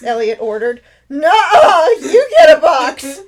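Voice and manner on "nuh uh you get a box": loud, high, refusing through laughter; laughing refusal. Acted. Hope refusing her brother's order, loud and laughing.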